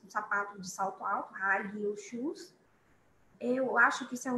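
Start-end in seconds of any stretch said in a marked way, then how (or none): 1.3-2.5 slow model phrase. Shown as a demonstration. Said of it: "model phrase".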